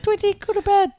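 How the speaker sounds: squeaky high scolding voice; a very high cartoon bird voice.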